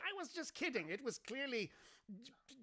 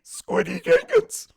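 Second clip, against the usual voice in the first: high, strained, squeaky voice; Squiddy's eerie high voice; creepy creature voice; 11 semitones higher, 15 dB louder than usual. Acted. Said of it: "Wait till you hear this voice".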